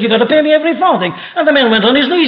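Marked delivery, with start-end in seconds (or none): 0.0-1.1 higher, fast, furious demand; harsh demand. Retold or imitated. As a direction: spit it out at the debtor. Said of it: "He said".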